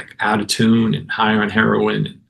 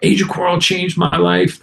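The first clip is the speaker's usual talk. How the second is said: higher-pitched, retold fan praise; gushing fan; 8 semitones higher than usual. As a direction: imitate an admirer moved by an album. Retold fan gushing over his album.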